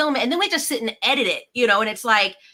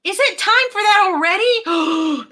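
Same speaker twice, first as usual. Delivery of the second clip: mock surprise; 10 semitones higher, 5 dB louder, slower than usual. Mock shock at reunion.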